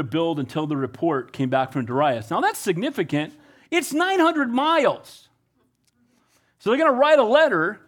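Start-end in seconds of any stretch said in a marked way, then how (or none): 3.7-5.3 high, slow, stressing "nine hundred miles"; emphatic. Acted. Stressing the great distance.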